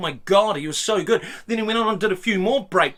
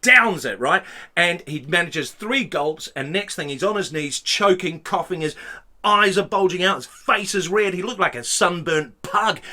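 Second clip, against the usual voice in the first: usual speaking voice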